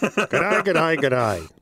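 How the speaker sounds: repetitive teasing Aussie greeting; mock Australian accent. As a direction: parrot a friend's Australian accent. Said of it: "You should hear him. All he says is".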